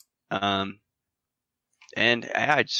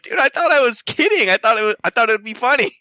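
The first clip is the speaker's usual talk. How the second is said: much higher, louder, fast protest; mock defensive protest; 10 semitones higher, 4 dB louder than usual. Telling his coworker he meant it as a joke, high and rushed. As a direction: blurt out the excuse in a rush. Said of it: "And I was like".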